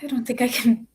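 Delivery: hushed, breathless confession; barely able to get the words out; whisper. Acted. Dreading to reveal the plans.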